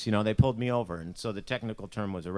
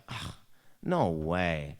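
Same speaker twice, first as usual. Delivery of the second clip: put-on disgusted teen refusal; 6 semitones lower, much slower than usual. Teen rejects mom's camp idea.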